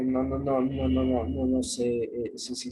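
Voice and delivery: stumbling, unsure repeated no's; hesitating. Shown as a demonstration. Teacher showing how someone hesitates, dithering. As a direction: waver as if you can't decide. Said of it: "cuando titubea".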